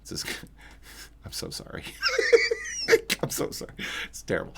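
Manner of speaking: whispered, fast, through laughter; breaking up at your own bad pun; laughing helplessly while apologizing to chat; laughing apology. Real, not acted. Host cracks up at own pun.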